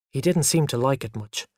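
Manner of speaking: ordinary delivery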